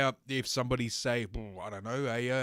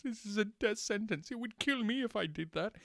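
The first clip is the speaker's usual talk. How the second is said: mock whiny voice; 8 semitones higher, 4 dB quieter than usual. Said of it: "that's the way she looks at it".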